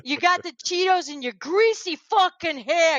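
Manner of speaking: high, indignant imitated tirade; Michael Rapaport. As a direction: act out a man losing it, stretching the insults. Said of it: "just losing his shit".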